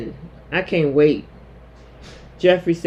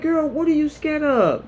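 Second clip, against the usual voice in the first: incredulous sass; 11 semitones higher than usual. Retold or imitated. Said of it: "It's like".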